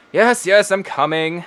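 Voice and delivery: a lightly pompous man calling from inside; mildly haughty, unhurried. Acted. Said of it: "a rather pompous one".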